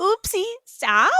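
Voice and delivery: put-on high oopsie with a babyish apology; cutesy baby talk. Co-host mocking the spoiler apology, cutesy baby talk. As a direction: apologize like a little kid, playfully.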